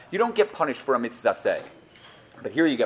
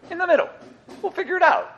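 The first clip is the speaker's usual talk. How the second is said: high, sing-song shrug of a voice; mocking the fence-sitters who wait to see; mock undecided. People undecided, mocked.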